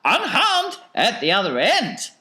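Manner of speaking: very high theatrical falsetto; solemnly fussing Wonka. A narrator's reading. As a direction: urge prayer in a piping eccentric voice.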